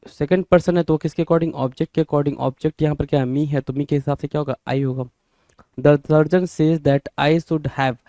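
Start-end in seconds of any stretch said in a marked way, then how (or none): 5.8-8.0 slow dictation. Shown as a demonstration. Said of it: "teacher reads sentence slowly".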